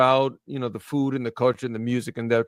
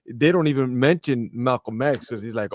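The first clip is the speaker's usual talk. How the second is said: usual speaking voice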